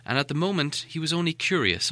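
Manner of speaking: ordinary delivery, nothing marked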